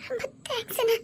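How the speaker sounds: softly weeping thanks to her husband's brother; choked tearful thanks. Acted. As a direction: whisper the thanks through crying. Wife thanks again, weeping.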